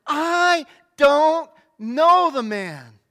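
very slow, loud sworn denial, each word dragged out; cursing denial. Acted. Peter swearing at those who heard his accent, slow loud cursing. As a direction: hurl it at them, calling down curses. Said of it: "Then he began to call down curses, and he swore to them".

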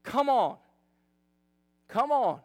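exasperated, punched-out plea; loud, falling burst of mock annoyance; seething. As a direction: hit it hard, fed up. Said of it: "she's just seething. Angrier and angrier".

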